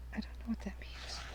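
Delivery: breathy whispered aside. Real, not acted. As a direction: murmur it under your breath.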